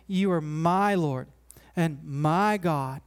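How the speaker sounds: weight on both uses of "my"; stress on MY. Shown as a demonstration. Preacher contrasts Thomas's own Lord with others', stressing MY. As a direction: hit "my" before Lord and before God. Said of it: "He doesn't say, "My parents' God".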